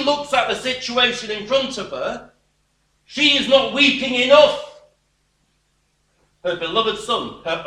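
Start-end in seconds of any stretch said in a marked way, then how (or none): 3.0-4.7 emphatic outcry. Real, not acted. Preacher stresses the widow's plight.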